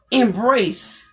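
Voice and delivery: usual speaking voice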